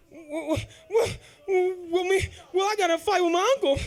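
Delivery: fumbling for an excuse; sheepish stammer. Retold or imitated. Bruised son explaining to mom, sheepish stammer.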